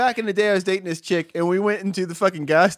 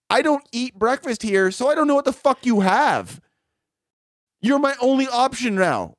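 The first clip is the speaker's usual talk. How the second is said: raised-pitch, frustrated protest; exasperated; 7 semitones higher than usual. Retold or imitated. Customer arguing about the missing breakfast menu, exasperated. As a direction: plead your case in mild exasperation.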